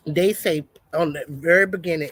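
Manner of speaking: usual speaking voice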